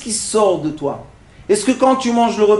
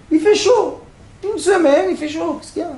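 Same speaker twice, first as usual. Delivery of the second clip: mock whining; 9 semitones higher than usual. Mock complaining about heat.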